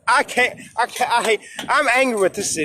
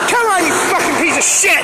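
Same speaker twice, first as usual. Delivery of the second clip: furious shout; 5 semitones higher than usual. Real, not acted. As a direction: yell at the device that won't work. Yelling at the device.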